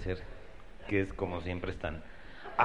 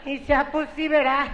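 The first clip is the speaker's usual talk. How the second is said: snooty wife; 4 dB louder than usual. Retold or imitated. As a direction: drag out a stuck-up lady voice.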